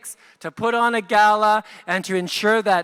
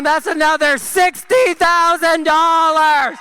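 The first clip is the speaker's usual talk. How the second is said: auction caller; 4 semitones higher, 3 dB louder than usual. Acted. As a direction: announce each bidder like a gala auctioneer. Auctioneer announcing bids, building.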